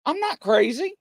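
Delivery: high-pitched, wounded mock protest; retold heartbroken protest. Heartbroken psychic defending himself to the waiting room, retold hurt protest. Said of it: "his heart was broken".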